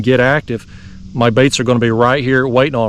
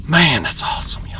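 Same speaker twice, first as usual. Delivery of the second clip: whisper; 5 dB quieter than usual. Real, not acted. Awed whisper over big fish.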